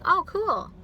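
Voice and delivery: mock chirpy agreeing; agreeable gush. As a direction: say it to please. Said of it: "agreeable people just going".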